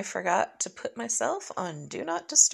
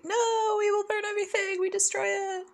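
high, fast squeaky cook voice; frantic cooks; 10 semitones higher, 3 dB quieter than usual. Retold or imitated. Narrating the shark game as panicked cooks. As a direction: squeak out the kitchen disaster. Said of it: "the waiters and the cooks are like".

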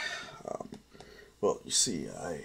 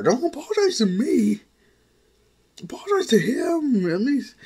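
put-on much higher, louder girl voice telling him off; a niece scolding her uncle, pitched up high; scolding young girl; 6 dB louder than usual.

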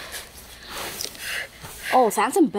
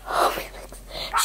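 whispering; 5 dB quieter, much slower than usual. Real, not acted. Whispering beside the dog.

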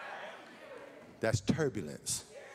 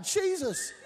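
a much higher mock scream of Jesus, drawn out. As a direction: scream the name like a panicked man on a shaking plane. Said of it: "started screaming out".

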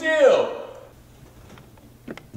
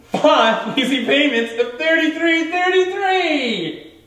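home shopping host; 8 semitones higher, 3 dB louder, slower than usual. Acted. Deadpan price repetition.

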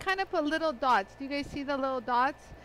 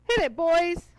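put-on louder, cheery hit it boys; playful call; 3 semitones higher, 7 dB louder than usual. Host cueing the boys to start the polisher, playful call.

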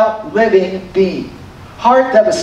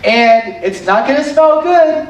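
high-pitched, hesitant heads-up; raised-pitch cautioning of a superior; piping up; 4 semitones higher than usual. Retold or imitated. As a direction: pipe up with an awkward warning. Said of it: "Martha pipes back up".

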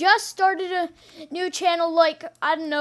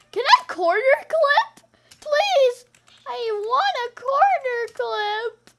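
pleading; 7 semitones higher than usual. Real, not acted. Begging to be allowed to clip.

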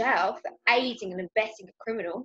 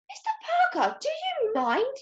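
high-pitched affronted protest; mock horror. A narrator's reading. Granny is seen undressed by Mister Parker, mock horror. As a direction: act scandalised, pitching it high. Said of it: "said Granny in mock horror".

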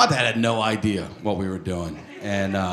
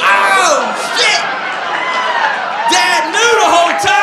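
high, loud stunned shout; drawn-out screams of disbelief; shocked yelling; 8 dB louder, slower than usual. Acted. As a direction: holler the sudden realisation. Acts kids realising dad knew.

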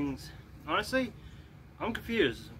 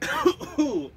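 sharp high ow of pain; pained yelp; 11 semitones higher, 6 dB louder than usual. Real, not acted. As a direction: yelp from a brain freeze. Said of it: "I am getting a brain freeze".

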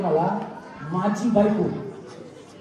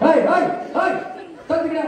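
mock shooing; 6 semitones higher, slower than usual. Mock shooing someone away.

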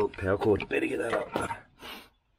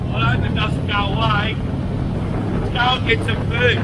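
inside a helmet; 6 semitones higher, 13 dB louder than usual. Real, not acted. Talking while riding with helmet on.

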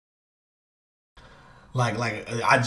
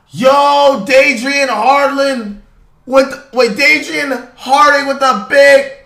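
much higher, louder, slow hype shout; 12 semitones higher, 10 dB louder, much slower than usual. Real, not acted. Streamer thanking a donor on stream, loud hype.

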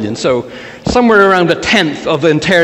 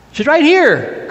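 high-pitched call back; 11 semitones higher than usual. Acted. Abraham answers the men outside, calling out. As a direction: answer from indoors, pitched well up. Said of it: "calls out Abraham".

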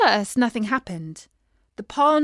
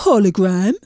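lower, rising baffled echo of "hologram"; puzzled fish; 4 semitones lower, 7 dB louder than usual. A narrator's reading. Fish puzzled by the princess's word. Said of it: "asked the fish".

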